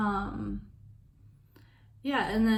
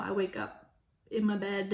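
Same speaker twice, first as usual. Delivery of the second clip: ordinary delivery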